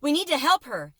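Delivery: woman shouting. Acted. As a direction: cry out for help. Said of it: "A woman shouted".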